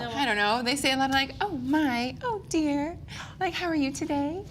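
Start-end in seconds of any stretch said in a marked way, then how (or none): 1.4-4.4 lightly voiced sweet princess, lilting higher; a hint of storybook princess; Disney princess. Shown as a demonstration. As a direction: let the voice go up at the end, very sweetly. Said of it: "voices go up at the end".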